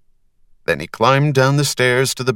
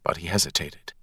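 usual speaking voice

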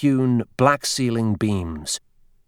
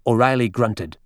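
ordinary delivery

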